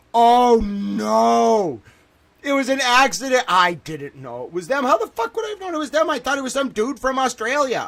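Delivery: panicked. Real, not acted. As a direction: insist it wasn't your fault in a real panic. Panicked denial to viewers.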